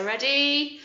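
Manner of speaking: ordinary talk, nothing marked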